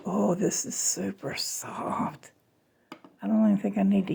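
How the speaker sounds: soft whispered aside; whispering. Real, not acted. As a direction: whisper it to yourself. Aside while touching the food.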